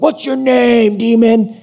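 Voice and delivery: high, drawn-out hammed-up command; mock exorcist. Preacher mimics would-be exorcist confronting a demon, hammed-up command. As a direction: call out the demon like a self-styled exorcist. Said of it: "Oh, I'm an exorcist".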